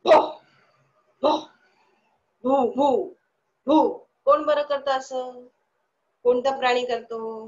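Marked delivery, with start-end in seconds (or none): 0.0-4.1 short, sharp barks getting louder; dog barking. Shown as a demonstration. Teacher barking like a dog so children guess the animal. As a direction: bark like a dog, sharp and punchy. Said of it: "अजून एक मी आवाज तुम्हाला ऐकवणार आहे".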